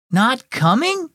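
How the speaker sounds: high, shocked outcry; upset cry. A narrator's reading. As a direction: cry it out in dismay. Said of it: "cried Sybil".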